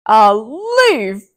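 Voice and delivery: loud scornful giant shout; giant yell. Acted. Giant scoffing at Mickey's leaf, loud shout. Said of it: "yelled the giant".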